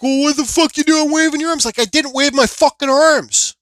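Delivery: high, loud back-and-forth bickering; retold angry argument. Two drivers arguing over waving arms, retold angry argument. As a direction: act out both sides of the row.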